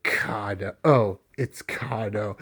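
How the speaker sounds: imitating a very low, soft grumble; low, quiet, put-on disdain; unimpressed groan. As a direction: drop far down for a mock sullen reaction. Retells Kurono's reaction on seeing Kado.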